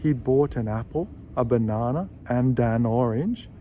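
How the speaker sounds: "bought an apple" and "an orange" joined smoothly; careful linked demo; linked sentence. Shown as a demonstration. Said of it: "will join the A".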